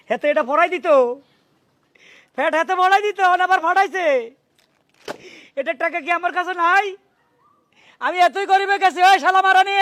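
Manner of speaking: high, rising furious rant; angry outburst. Acted. Husband raging over a parcel sent to his wife, furious. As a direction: boil over with rage, pitch climbing.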